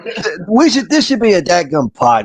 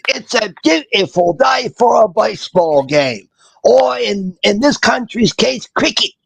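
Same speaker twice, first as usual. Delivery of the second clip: put-on high, drunk cricket announcer; British Harry Carey; 9 semitones higher than usual. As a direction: boom it like a tipsy sportscaster. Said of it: "You sound like a drunk cricket announcer".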